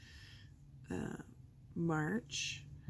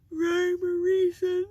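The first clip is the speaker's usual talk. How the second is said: much higher, far louder, slowed; loud exclamation; 11 semitones higher, 15 dB louder than usual. Real, not acted. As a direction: belt it out like a dramatic proclamation. Exclaiming about random flower clump.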